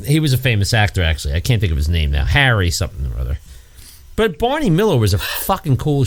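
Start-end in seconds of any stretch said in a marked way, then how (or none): none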